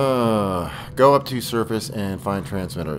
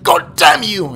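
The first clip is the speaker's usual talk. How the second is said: angry outburst; 6 semitones higher, 12 dB louder, slower than usual. Pretend. Mock outburst.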